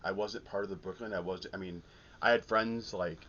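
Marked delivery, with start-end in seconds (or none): none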